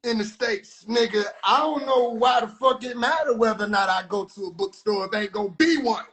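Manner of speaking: usual speaking voice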